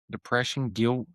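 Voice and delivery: ordinary delivery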